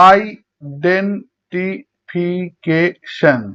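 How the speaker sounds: Identification very slowly in six beats; syllable by syllable. Shown as a demonstration. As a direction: break identification apart with even pauses. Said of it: "ছয়বার উচ্চারণ করা হয়".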